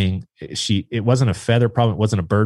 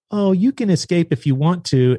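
high-pitched imitation of wife; raised, singsong mock wife; wife's voice; 10 semitones higher than usual. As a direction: lightly mimic a wife talking to a duck, pitched up. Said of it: "She's like".

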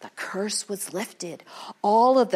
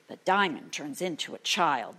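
usual speaking voice

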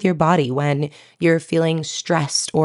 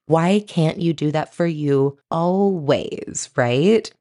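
usual speaking voice

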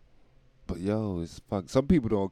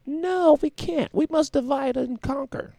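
mock villain voice. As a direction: ham up a scheming overlord. Mock villain reply to own question.

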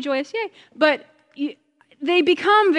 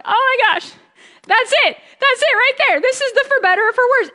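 higher, emotional exclamation of recognition; moved excitement; 8 semitones higher than usual. Retold or imitated. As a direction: burst out as if struck by the truth. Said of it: "just going".

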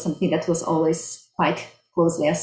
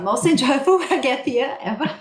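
higher-pitched, amused and laughing through it; 7 semitones higher than usual. Real, not acted. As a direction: let a real laugh break into the joke. Joking about her long gap year.

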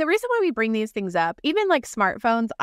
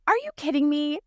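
higher, incredulous first try; exasperated disbelief; 5 semitones higher, faster than usual. Retold or imitated. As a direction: ask it in disbelief at the email. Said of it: "when you're just like".

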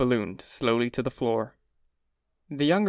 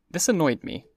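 ordinary delivery

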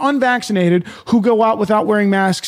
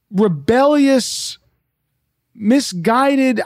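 ordinary talk

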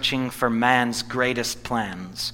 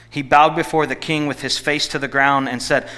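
ordinary delivery

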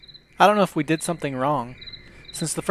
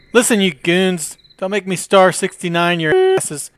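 loud, irritated yelling into the phone; angry; 3 semitones higher, 7 dB louder than usual. Acted. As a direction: snap at the prank callers. Grumpy man answering prank calls.

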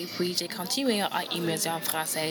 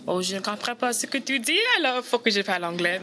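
exasperated inner voice; 5 semitones higher, 5 dB louder than usual. Retold or imitated. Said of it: "I'm like".

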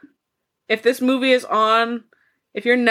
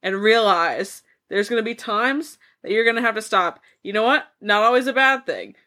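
ordinary delivery

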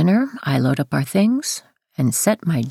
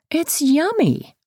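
high, drawn-out coaxing; 13 semitones higher, slower than usual. A narrator's reading. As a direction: sell it to a child in a high voice. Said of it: "I say, putting the spoonful".

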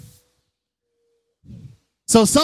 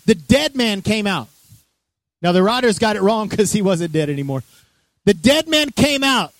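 ordinary delivery

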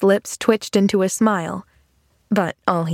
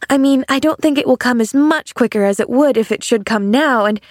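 put-on higher child's voice, flustered and earnest; earnest little girl Pollyanna; 3 semitones higher than usual. Girl correcting man about doomsday, earnest and flustered. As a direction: tumble through a child's anxious explanation.

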